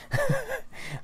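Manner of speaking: a sudden high burst of laughter; big laugh. Real, not acted. Laughing at how funny it is that his friend watches the channel.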